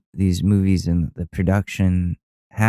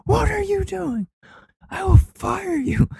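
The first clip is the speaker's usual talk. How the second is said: much higher furious mock Tom Cruise shout; Tom Cruise yelling. Tom Cruise yelling at a rule-breaker over FaceTime, hammed-up high shout. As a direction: scream at them like an enraged movie star. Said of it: "Tom Cruise yell at you".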